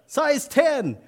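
very high, loud recruit answer; a recruit shouting his size back; shouted reply. Acted. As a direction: yell back to the sergeant.

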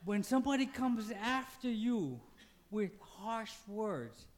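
ordinary delivery

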